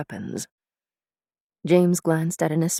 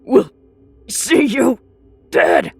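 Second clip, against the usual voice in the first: gasping shout, building; 5 semitones higher, 6 dB louder than usual. Acted. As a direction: threaten him with everything left in you. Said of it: "He gasped and shouted".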